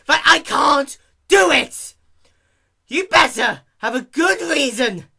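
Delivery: high, loud furious outburst; screaming in outrage; raging shout. Acted. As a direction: erupt at the clerk. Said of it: "almost erupted into a fit of rage".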